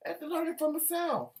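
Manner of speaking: high, mocking repeat of the line; mock sing-song echo. Shown as a demonstration. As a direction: lift it up high and play with it.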